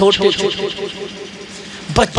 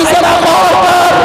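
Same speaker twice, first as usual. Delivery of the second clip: calling out; 7 semitones higher than usual. Acted. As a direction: shout to wake the listeners. Preacher calls to listeners. (ज़ोर से पुकारना)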